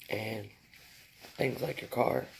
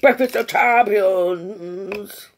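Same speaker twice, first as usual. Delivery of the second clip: high, loud and slow, like a TV announcer; 7 semitones higher, 11 dB louder, much slower than usual. Pretend. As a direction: boom it out like a cereal ad slogan. Cheery slogan after leftovers joke.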